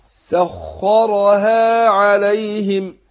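slow chanted tajweed recitation, heavy nasal hum; Quran recitation. Shown as a demonstration. Teacher demonstrating recitation to students, slow chant. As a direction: recite slowly, drawing out the ghunna. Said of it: "شوف الخاء عشان مفتوحة مشددة".

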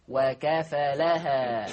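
"وكفلها" mit einfachem Fa, sehr langsam; "وكفلها" leicht, Fa nicht betont; Fa ohne Verdopplung. Shown as a demonstration. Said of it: "بتخفيف الفاء".

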